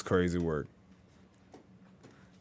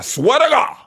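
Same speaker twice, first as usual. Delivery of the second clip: exasperated; 3 dB louder, slower than usual. Real, not acted. Exasperated aside to viewers.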